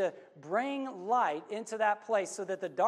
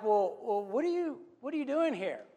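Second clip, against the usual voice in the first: startled, rising high on "what are you"; pitched-up bewildered question; surprised; 5 semitones higher than usual. Retold or imitated. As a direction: caught off guard, voice jumping up. Man surprised by women in his home.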